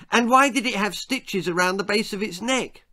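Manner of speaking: put-on high, loud boyish interrogation; insistent boy Jack. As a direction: press on like an unstoppable child.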